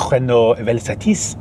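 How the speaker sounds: slow, hammed-up French accent on Renault Velsatis. Shown as a demonstration. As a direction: say the car name like a Frenchman would, slowly. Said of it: "I'll do the accent".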